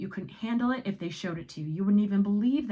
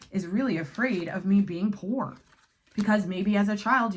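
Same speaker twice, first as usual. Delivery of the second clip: ordinary delivery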